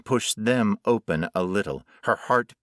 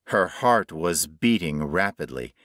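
plain reading voice

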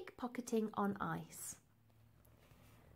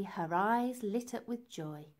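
plain reading voice

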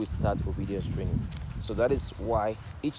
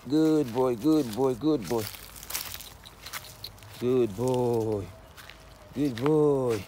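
squeaky praising a dog; 3 semitones higher, much slower than usual. Real, not acted.

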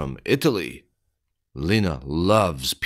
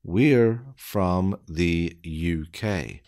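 slow, deliberate, word by word; careful slowed-down reading; slow dictation; slower than usual. Shown as a demonstration. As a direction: spell it out slowly for beginners. Slow repeat for learners.

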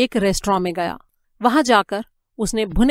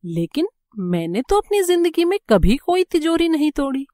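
high-pitched, astonished protest; surprised; 7 semitones higher than usual. A narrator's reading. Prisoner answering the warden's accusation of safe-cracking, surprised. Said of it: "Jimmy ने हैरानी से कहा".

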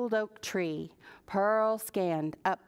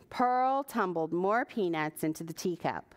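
ordinary delivery, nothing marked

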